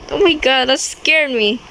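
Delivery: normal talking voice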